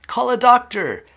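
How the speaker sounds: very high, loud call; panicked shout. A narrator's reading. Father calling for a doctor, shouting. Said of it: "shouted Mister Beauregard".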